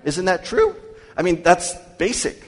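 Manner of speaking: rising preacher insistence; emphatic. Acted. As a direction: throw it out to the congregation. Preacher drives point home.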